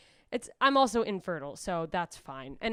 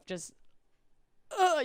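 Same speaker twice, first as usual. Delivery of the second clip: normal talking voice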